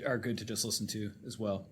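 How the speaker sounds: usual speaking voice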